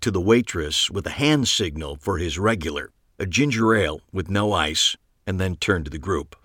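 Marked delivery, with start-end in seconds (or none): none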